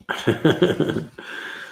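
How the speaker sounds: real laugh trailing on; amused chuckle. Real, not acted. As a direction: laugh at your own joke. Laughs at own wage joke.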